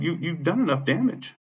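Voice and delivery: slow, high-pitched indignant blame; retold reproach. Tenant blaming the property manager, indignant.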